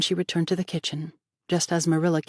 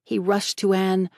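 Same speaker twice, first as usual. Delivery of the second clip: ordinary delivery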